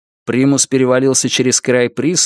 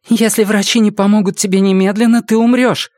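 worried woman; 9 semitones higher, 3 dB louder than usual. A narrator's reading. Лаура тревожно предупреждает Йону.

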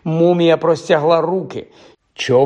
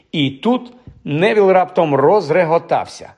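ordinary delivery

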